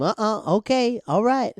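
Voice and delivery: very high, loud flustered mom; mom shutting it down. Retold or imitated. Mom stopping her son's dirty joke, very high and loud. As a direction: cut the show off in a high panic. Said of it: "see her stand up".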